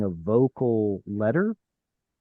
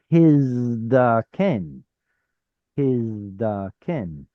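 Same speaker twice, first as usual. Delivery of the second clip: "his da ken" drawn out piece by piece; slow sounding out; much slower than usual. Shown as a demonstration. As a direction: stretch each syllable of "his da ken" slowly. Teacher sounding out a word.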